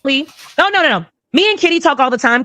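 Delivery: ordinary talk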